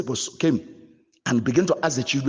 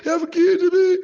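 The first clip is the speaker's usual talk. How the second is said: high, loud wailing cry; a husband mimicking his wife's fake crying on the phone, high mock wail; 5 dB louder, slower than usual. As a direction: wail it like she is being killed. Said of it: "begin to cry while she's calling you".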